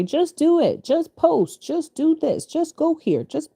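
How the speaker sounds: high, mocking voice of people pushing you; sing-song mockery of "just do it" advice; mock pushy advice. Mimicking pushy advice givers.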